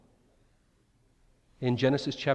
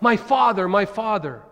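anguished cry; 6 semitones higher, 7 dB louder than usual. Retold or imitated. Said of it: "Elisha cries out".

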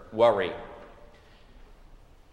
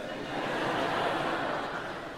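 quiet breathy laugh; soft chuckle; 8 dB quieter than usual. Real, not acted. Laughing at hip-hop lyrics.

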